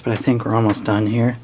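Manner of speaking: ordinary delivery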